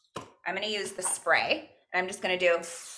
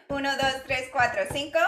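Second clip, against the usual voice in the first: laughing through a higher count; "Uno, dos" said while still laughing, invite them to join in; 5 semitones higher, faster than usual. Shown as a demonstration. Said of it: "Can you say it with me?".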